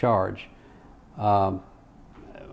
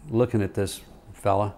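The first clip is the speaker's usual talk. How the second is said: ordinary talk, nothing marked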